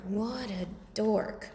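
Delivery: usual speaking voice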